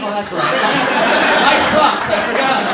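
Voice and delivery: loud, laughing self-pity; mock despair. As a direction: wail it like life just ended. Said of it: "I'm like".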